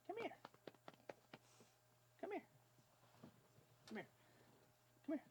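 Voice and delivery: very high soft call; coaxing high voice. Real, not acted. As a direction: coax the puppy over. Calling puppy high.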